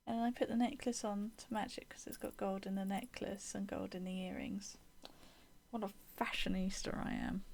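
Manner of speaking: soft whispered aside; whispering. Real, not acted. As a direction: whisper it to yourself. Whispered self-mocking aside.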